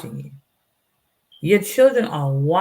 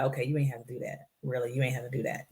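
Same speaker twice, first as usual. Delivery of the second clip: low, soft, quick talking to oneself; retold self-reproach; 4 semitones lower, 9 dB quieter, faster than usual. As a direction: quietly tell yourself off, quickly. Scolding herself in retold inner talk.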